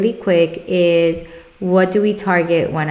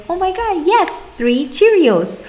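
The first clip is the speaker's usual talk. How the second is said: big celebration; 11 semitones higher than usual. Shown as a demonstration. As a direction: cheer like the child just won. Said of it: "celebrate them really, really, really big".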